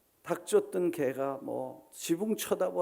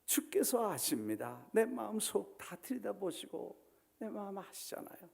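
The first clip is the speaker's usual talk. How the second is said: broken confession; 6 dB quieter than usual. Acted. Peter confessing to Jesus, fading to a whisper. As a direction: let it sink into a humbled whisper. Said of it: "베드로가 놀래서".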